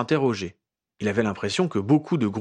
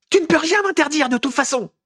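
much higher, louder furious yell; 6 dB louder than usual. A narrator's reading. Furious Ginny yells at Harry, much higher and louder. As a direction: yell it at him, eyes blazing. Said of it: "hurla Ginny".

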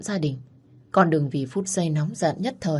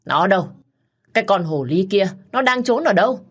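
loud, rising higher and higher; furious yell; 3 semitones higher, 5 dB louder than usual. Acted. Mother storming into her son's house, furious yell. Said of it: "mặt hầm hầm giận dữ".

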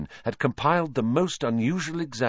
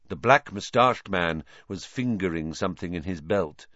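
ordinary delivery

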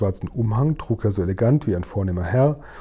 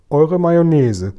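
higher, louder, slow giant's greeting; courtly giant; 5 semitones higher, 6 dB louder, slower than usual. Acted. Giant bows to the Queen at the window, grandly courteous. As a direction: address royalty grandly and slowly, as a giant.

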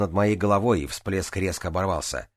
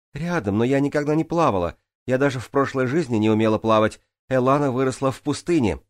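high, raised-voice protest; calling back; 7 semitones higher, 4 dB louder than usual. A narrator's reading. Джанель explains herself to those below, calling out. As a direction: defend yourself across a distance. Shouted defence from the cliff.

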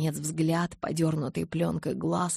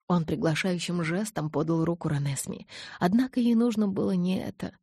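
plain reading voice

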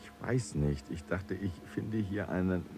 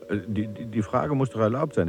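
stammering start, then fast, higher and louder; flustered insistence; 4 semitones higher, 4 dB louder, faster than usual. Acted. Visitor insisting to the gods that he may ask, flustered. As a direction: blurt it out, tripping over the first words.

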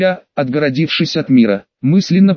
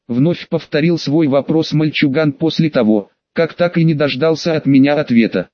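plain reading voice

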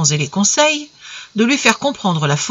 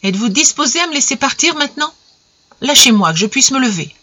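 sèche et froide; 6 semitones higher than usual. A narrator's reading. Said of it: "elle répondit seulement".

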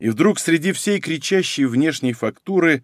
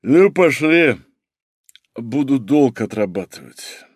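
tipsy; slower than usual. Acted. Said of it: "пьяно щурясь".

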